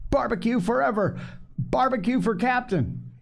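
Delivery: high-pitched crowd cheer; crying out. A narrator's reading. Mutineers acclaim Barbecue as captain, high cheering cry. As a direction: chant it up high like a cheering crew. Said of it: "they cried".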